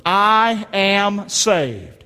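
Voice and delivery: very slow, each word held out; slow declaration. Shown as a demonstration. Preacher leads congregation repeating after him, slow declaration.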